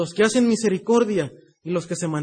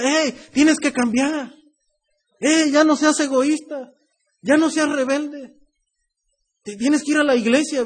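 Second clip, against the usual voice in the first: loud, higher-pitched telling-off; parent's scolding; 5 semitones higher, 4 dB louder than usual. Acted. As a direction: scold the kids, voicing an exasperated parent. Parent exhorting the children.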